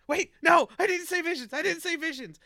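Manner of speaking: high, loud hurried denial; panicked backpedaling. Retold or imitated. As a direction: take it back in a panic. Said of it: "be like".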